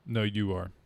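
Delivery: ordinary delivery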